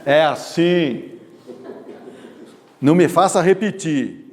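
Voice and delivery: a hint of a bossy threat; mild aggressive. Shown as a demonstration. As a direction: lay down the law, slowly. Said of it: "O agressivo".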